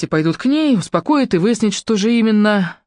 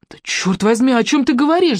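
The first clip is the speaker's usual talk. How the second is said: higher, exasperated outburst; mildly shouted, pitched-up demand; exasperated cry; 7 semitones higher than usual. A narrator's reading. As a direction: lose patience and cry out. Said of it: "закричала Маша, не выдержав".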